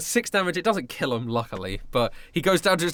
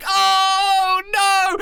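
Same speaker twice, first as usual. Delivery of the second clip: mock despair; 11 semitones higher, 4 dB louder than usual. Guard mimics their panic.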